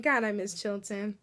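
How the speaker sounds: ordinary talk, nothing marked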